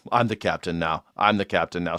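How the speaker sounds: low, soft quoted pirate line. Retold or imitated. Streamer softly quotes the pirate captain line. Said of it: "who's the pirate".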